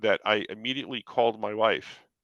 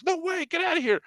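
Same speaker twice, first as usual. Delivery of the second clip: much higher, squealed "No way"; disbelieving friend; 4 dB louder than usual. Retold or imitated. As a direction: voice Art's stunned disbelief high up. Art's stunned reaction.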